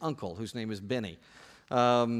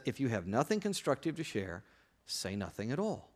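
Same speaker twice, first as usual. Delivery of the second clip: lower, softer quoted advice; hushed, understated quote; mommy's gentle admonition; 4 semitones lower, 6 dB quieter than usual. Acted. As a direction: drop the voice for a motherly maxim. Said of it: "Paul foreshadows my mommy".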